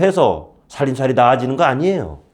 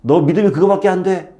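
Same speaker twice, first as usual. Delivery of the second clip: mock reproach; 7 semitones higher, 3 dB louder than usual. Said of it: "뭐라고 하지 않으세요".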